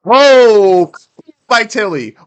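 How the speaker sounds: high, loud shout of surprise; excited shout. Real, not acted. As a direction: yell it out at the big play. Reacts to a big play.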